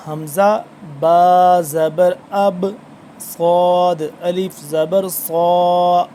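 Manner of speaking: حمزہ با زبر اب and صاد الف زبر صا spelled slowly and loudly; slow spelling drill. Shown as a demonstration. Teacher demonstrating spelling to learners, slow and emphatic. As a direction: drill each letter name slowly, leaning on صاد. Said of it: "اب اس کو اب کر کے پڑھنا ہے".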